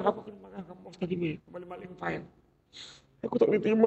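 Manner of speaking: hushed, fast complaint to a friend; complaining to a friend. Retold or imitated. Worker vents to a friend about the boss, hushed and fast. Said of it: "dia mengadu dengan kawan".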